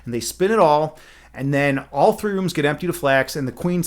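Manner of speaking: ordinary delivery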